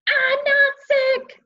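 loud, high shout; angry shout at mom. A narrator's reading. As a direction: yell it out at full force. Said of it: "I shouted".

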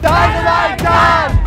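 high, drawn-out shout; big high-pitched entrance shout; shouted name reveal. Acted. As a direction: yell the hero's name slowly. Hero's name called out as entrance.

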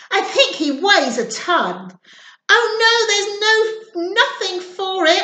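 ordinary delivery

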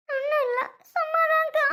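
high, wailing denial through tears. Acted. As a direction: wail it out while insisting it's nothing. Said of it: "இப்படி அலற". (அழுதுகொண்டே அலறல்)

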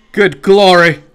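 Good glory! called out loud; loud exclaim. Real, not acted.

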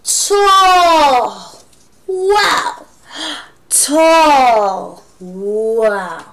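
awed tall. Shown as a demonstration. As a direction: act wowed for the class. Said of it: "emphasize the word tall".

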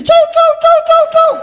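very high, loud, squeaky sing-song chau; Tinelli's goodbye. Retold or imitated. Comic tale, Tinelli waves off in shrill high chau chant. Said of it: "viene Tinelli y dice".

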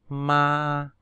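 usual speaking voice

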